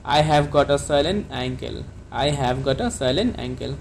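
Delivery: slow, clear model sentence for learners; careful classroom dictation pace; slow teaching model. Shown as a demonstration. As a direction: say it slowly so students can repeat. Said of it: "तो आप इसके लिए क्या कहेंगे?".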